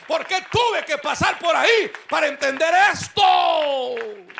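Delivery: loud, high, slow shout; joyful crying shout. Retold or imitated. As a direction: shout the realization through tears. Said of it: "Y cuando él está llorando".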